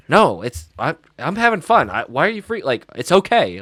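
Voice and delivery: high, loud protest; exasperated kid. Retold or imitated. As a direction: insist brightly that it's fine. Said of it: "he goes".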